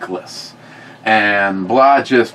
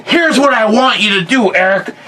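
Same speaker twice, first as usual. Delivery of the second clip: high-pitched, emphatic imitated grandpa; commanding grandfather; 9 semitones higher than usual. Grandfather instructing his grandson, mock stern. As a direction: lay down the order in a raised grandpa voice.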